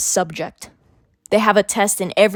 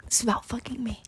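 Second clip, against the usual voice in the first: whisper; 11 dB quieter, faster than usual. Real, not acted. Teen ranting about old school.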